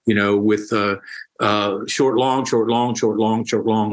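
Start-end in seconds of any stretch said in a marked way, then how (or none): 1.9-3.9 chanting short, long in a steady beat; short long beat pattern. Shown as a demonstration. Teacher to podcast host, tapping out iambic pentameter with the words. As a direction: tap out the iambic rhythm with the words. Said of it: "like that".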